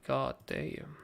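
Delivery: normal talking voice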